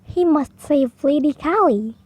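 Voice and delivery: ordinary delivery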